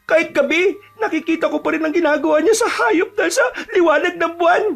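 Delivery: high, whimpering dread; frightened. Acted. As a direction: watch in horror, almost crying. Terrified friend watches creature from hiding.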